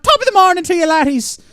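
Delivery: high, loud hammed-up Irish accent; Irish accent impression. Streamer voicing a tiny Irish man, hammed-up Irish accent. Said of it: "He's a foot tall Jacksepticeye".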